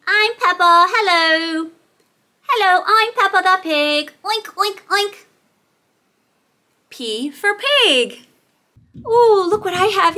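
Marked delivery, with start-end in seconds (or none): 0.1-5.1 high cheerful piggy voice with oinks; Peppa Pig voice. Acted. Teacher voicing Peppa toy for toddlers, high and cheerful. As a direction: play the cute pig toy.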